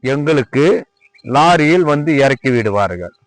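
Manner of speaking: ordinary delivery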